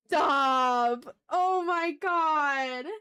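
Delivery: loud, delighted stop then a drawn-out oh my god; loud stunned joy; excited outburst. Real, not acted. Reacting to fan art.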